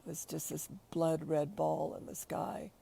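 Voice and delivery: ordinary talk, nothing marked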